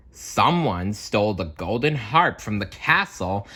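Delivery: ordinary delivery, nothing marked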